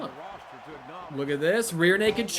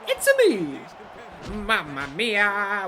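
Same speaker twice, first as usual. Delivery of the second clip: Mario impression; 4 dB louder, much slower than usual. Vlogger mocks fans expecting the movie hero's classic voice, hammed-up plumber impression. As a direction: ham up the famous plumber catchphrases. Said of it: "did you expect him to be like".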